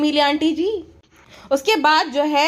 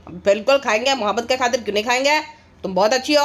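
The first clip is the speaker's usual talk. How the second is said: much lower, dopey mock husband voice; 4 semitones lower than usual. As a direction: answer like a henpecked man. Voicing husband's reply.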